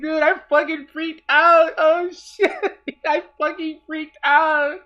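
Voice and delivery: high, loud, drawn-out fan elation; loud, slow, overwhelmed excitement; freaking out. Real, not acted. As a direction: relive the thrill, almost wailing.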